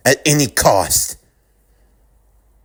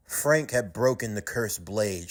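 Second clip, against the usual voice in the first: ordinary delivery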